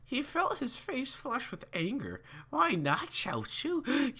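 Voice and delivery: high, hammed-up old man. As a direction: read it as a squeaky old man. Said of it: "I'll do the old man voice".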